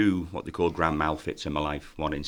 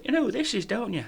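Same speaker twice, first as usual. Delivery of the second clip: very high-pitched mock Geordie; squeaky Geordie impression; Geordie accent. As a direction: nudge a mate in excitable Geordie. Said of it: "these two Geordies walk in".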